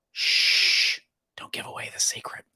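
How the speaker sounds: hushed mock whisper. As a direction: whisper it like a secret. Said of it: "And my response was".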